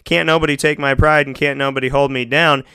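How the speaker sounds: normal talking voice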